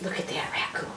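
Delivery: hushed whisper; soft, breath-only aside. Real, not acted. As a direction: whisper it as if sharing a picture. Aside while showing book picture.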